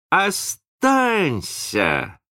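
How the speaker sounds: high, long-drawn plea; stretched-out, high cry to stay; pitying cry. A narrator's reading. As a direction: call him back with pity. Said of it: "закричали, жалея его мальчики".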